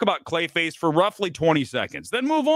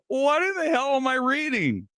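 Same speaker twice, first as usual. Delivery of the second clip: ordinary delivery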